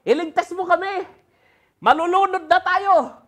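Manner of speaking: high, loud frantic pleading; panicked disciples. Acted. Disciples waking a sleeping Jesus in the storm, frantic and loud. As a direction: cry out as if the boat is sinking. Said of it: "parang gano'n yung tono eh".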